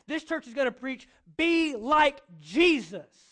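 full-force preaching. Acted. Pastor rallies his congregation toward holiness, preaching loudly. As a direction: proclaim it to the whole room.

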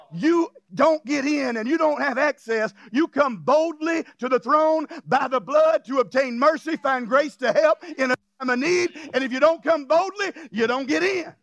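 emphatic, driving sermon delivery; forceful pulpit exhortation; preaching. Real, not acted. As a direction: press the point on the congregation.

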